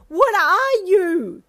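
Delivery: high, loud, slow shriek; a pinned traveller shrieking at a boar face. A narrator's reading. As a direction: shriek it in sheer shock. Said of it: "you shrieked at it".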